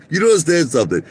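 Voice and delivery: a hint of a smug CEO; mocking CEO voice. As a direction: lecture him like a greedy boss. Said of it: "He goes".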